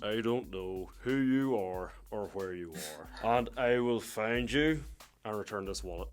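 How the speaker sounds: slow, low Irish threat turned harmless; Irish accent, mock menace, slow; hammed-up Irish tough guy, slow. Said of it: "these Irish accents sound pretty epic".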